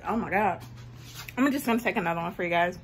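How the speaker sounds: ordinary delivery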